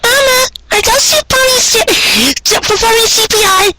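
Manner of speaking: put-on high, small child's voice, wide-eyed; little filly voice. A narrator's reading. Child pony asking her mother, high childlike voice. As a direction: voice a curious little foal.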